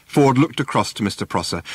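ordinary delivery

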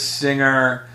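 ordinary talk, nothing marked

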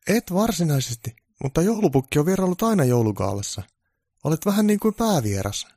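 high producer voice coaxing; producer's high coaxing; persuasive producer. Acted. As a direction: coax him onto the show.

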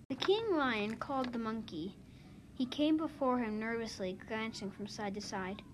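ordinary delivery, nothing marked

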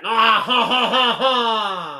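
loud, high, drawn-out fake French guffaw; mock French laugh. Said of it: "if they don't have that".

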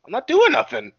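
put-on kid voice. The kid denying the prank to the teens, squeaky voice. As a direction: whine it like a sulky little kid.

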